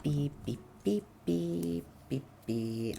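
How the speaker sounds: soft, mouth-made beeps; idle beeping. Real, not acted. Streamer waiting for a sent pic, idle beeping. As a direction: beep quietly to fill the time.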